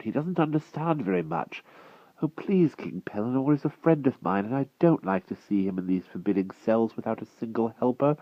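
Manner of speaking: put-on earnest small boy speaking up; boy defending his friend to Merlin; young boy pleading. A narrator's reading. The wart begging.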